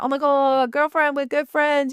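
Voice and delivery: a hint of a high, slow gushing friend; mock a fake best-friend gush; gushing fake bestie. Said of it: "I see people talking about".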